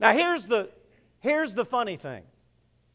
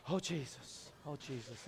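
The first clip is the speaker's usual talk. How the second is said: low-key murmur; 8 semitones lower, 19 dB quieter than usual. Retold or imitated.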